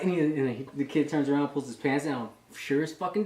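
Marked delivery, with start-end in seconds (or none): none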